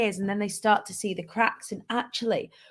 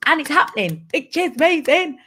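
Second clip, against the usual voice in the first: bursting up high and loud with real excitement; excited; 7 semitones higher, 6 dB louder than usual. Real, not acted. Sharing big business news with followers, genuinely thrilled. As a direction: let the good news lift the voice right up.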